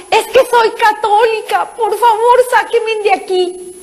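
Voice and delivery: high pleading cry, slow at the end; desperate cry. Retold or imitated. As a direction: cry out to be saved. (grito suplicante agudo)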